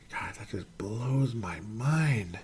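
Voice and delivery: slow, stunned whisper; awed whisper. Real, not acted. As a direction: breathe it out in disbelief. Stunned by a career stat.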